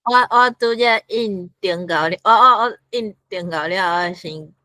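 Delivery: ordinary delivery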